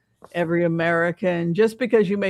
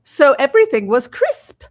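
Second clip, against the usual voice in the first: a touch of crisp, louder theatre diction; mock mid-Atlantic enunciation; mid-Atlantic accent; 5 semitones higher, 7 dB louder than usual. Shown as a demonstration. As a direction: lightly demonstrate the old stage accent. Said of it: "a way of enunciating".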